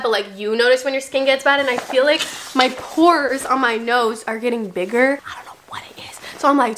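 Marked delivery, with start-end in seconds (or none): 5.3-6.0 softly whispered; whispered aside. Real, not acted. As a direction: whisper it as a confession. Aside about pores.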